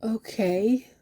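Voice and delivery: dubious okay with a rising end; doubtful. Acted. Non-religious worker answering colleague's testimony, doubtful. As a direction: draw it out, unconvinced.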